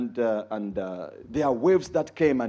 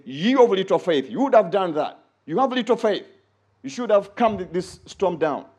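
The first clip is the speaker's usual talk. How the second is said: rebuking Jesus; 5 semitones higher, 5 dB louder than usual. Retold or imitated. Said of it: "Jesus said".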